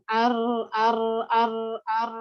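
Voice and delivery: r trilled over and over; rolled r demo. Shown as a demonstration. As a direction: roll the r many times. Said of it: "atau akan keluar berkali-kali". (r yang bergetar berulang-ulang)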